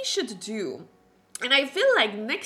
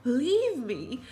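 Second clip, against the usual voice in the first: much softer, anxious inner voice; soft worried Rowan; 2 semitones higher, 6 dB quieter than usual. Acted. Rowan fretting to himself about disappointing Helena, much softer. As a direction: fret quietly to yourself.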